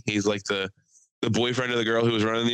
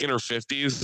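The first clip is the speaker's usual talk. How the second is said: usual speaking voice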